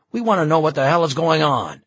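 loud, high, fast angry demand; bitter. A narrator's reading. Bystander demands to know what's going on, bitterly. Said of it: "came a bitter reply".

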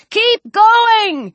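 high, urgent yell; shouted. A narrator's reading. Nathan waves the riders on, shouting. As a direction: shout them onward as they pass. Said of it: "Nathan shouted".

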